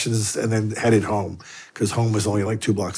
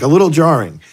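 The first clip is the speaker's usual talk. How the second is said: louder, disbelieving echo of his words; incredulous echo; 6 semitones higher, 8 dB louder than usual. Retold or imitated. Echoing the priest's understatement back at him, incredulous and louder.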